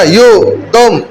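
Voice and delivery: ordinary delivery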